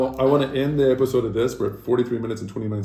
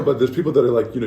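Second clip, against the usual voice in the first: normal talking voice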